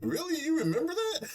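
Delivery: high, laughing fake surprise; mock amazement. Ainz pretending to remember the talk, high laughing fake surprise. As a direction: gush with laughing disbelief. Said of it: "brings it up and is like".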